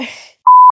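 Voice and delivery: squealing laugh; high shriek of laughter. Real, not acted. Host cracking up after a failed take, shrieking laugh.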